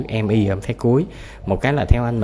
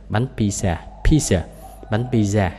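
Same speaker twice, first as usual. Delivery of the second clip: ordinary talk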